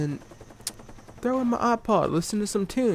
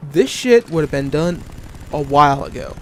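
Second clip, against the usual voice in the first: louder, venting at the unfair workload; louder, fed-up rant; 3 semitones higher, 6 dB louder than usual. Real, not acted. Worker ranting about supervisor giving him no help, louder and exasperated. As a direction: let the frustration burst out loud.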